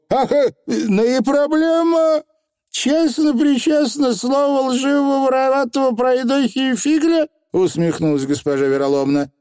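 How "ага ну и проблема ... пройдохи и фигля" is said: very high little voice; glib Feegle oath. A narrator's reading. Feegle swearing to the witch, high and glib. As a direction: rattle off a solemn-silly vow.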